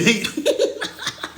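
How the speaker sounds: high, breathless real laughing; wheezy laugh. Real, not acted. Storyteller losing it over old lady, wheezing laughter. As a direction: laugh until the voice gives out.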